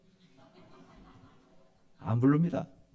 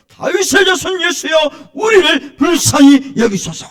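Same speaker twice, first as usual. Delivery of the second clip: shouted, much higher pleading; crying out; 12 semitones higher, 13 dB louder than usual. Retold or imitated. Blind men shouting to Jesus, loud pleading. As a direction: beg at the top of your voice. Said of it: "막 소리를 질러요".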